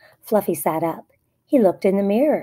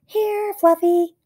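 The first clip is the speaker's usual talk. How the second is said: high coaxing call; calling pet; 11 semitones higher, 3 dB louder, slower than usual. A narrator's reading. Dad calling for lost guinea pig, high coaxing call. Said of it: "called Emma's dad".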